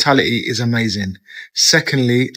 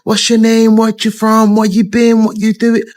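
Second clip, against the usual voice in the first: eager welcome; 7 semitones higher than usual. Retold or imitated. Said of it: "They're like".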